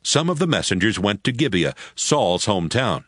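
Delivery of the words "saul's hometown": normal talking voice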